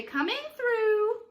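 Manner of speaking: high, loud, drawn-out call. Shown as a demonstration. Director shows Potts and Chip their entrance, calling out high and loud. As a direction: sing it out as you push through. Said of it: "come through and say".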